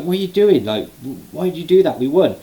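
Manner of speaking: high, incredulous questioning; mock bewildered protest. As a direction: protest in disbelief, imitating the wrestler. Wrestler confronts partner after kick.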